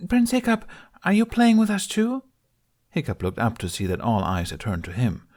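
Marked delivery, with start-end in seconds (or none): 0.1-2.2 put-on very high small child's voice; little boy. A narrator's reading. A little boy tugging Hiccup's cloak, high asking. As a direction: ask up shyly like a tiny boy.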